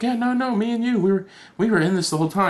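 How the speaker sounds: creepy fan insisting to his idol; Riddler impression. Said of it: "And he's like".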